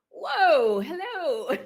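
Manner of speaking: delighted "Whoa, hello" with a laugh, high and loud; surprised. Retold or imitated. Patient greeting friends on a hospital video call, surprised and delighted. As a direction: caught off guard by a happy surprise. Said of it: "and I was like".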